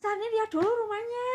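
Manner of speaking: high, loud retelling of grandpa; mock grandpa voice; grandpa's request. Grandpa tells little Sani to see the house first.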